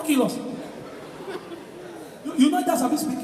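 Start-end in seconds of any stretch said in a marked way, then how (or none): none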